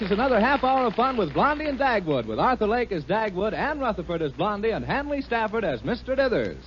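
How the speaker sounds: ordinary talk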